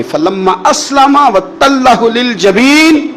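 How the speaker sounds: slow melodic Quran recitation. Acted. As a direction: draw the verse out, chanting. (آہستہ لحن کے ساتھ تلاوت)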